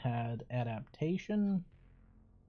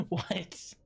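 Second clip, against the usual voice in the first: ordinary delivery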